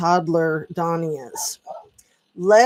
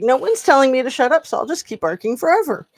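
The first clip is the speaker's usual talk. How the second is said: high, loud, fast defiant dog voice; voicing the dog; 8 semitones higher, 5 dB louder, faster than usual. Owner voicing her dog's stubborn reply, high and fast. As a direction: play the dog refusing to be hushed, quick and loud. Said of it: "She's just like".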